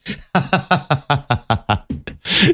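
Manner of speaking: high, breaking-up laughing fit; real run of rapid laughs; helpless laughter. Real, not acted. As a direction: crack up mid-story, unable to go on. Cracks up at own story.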